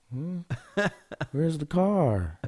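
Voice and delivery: put-on high, slow, dopey child voice; drunk-like dazed kid. Tired son picked up from school, imitated as if drunk. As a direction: imitate a spaced-out little kid, drawn out. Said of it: "like he's drunk or something".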